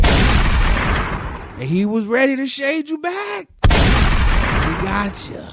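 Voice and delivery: high, slow hammed-up comeback; mock comeback voice. Host retelling a roast, mock high comeback. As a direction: ham up the clapback. Mocking a shade-back moment.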